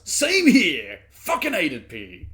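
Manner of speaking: high, loud burst of agreement; loud exclamation. Real, not acted. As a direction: shout it out in sympathy. Reacts loudly to diary.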